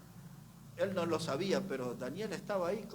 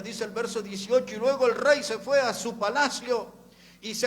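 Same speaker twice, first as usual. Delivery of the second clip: loud, high, fervent sermon reading; preaching at full force; 7 semitones higher, 13 dB louder than usual. Acted. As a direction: proclaim the scripture to the congregation. (predicando con toda la fuerza)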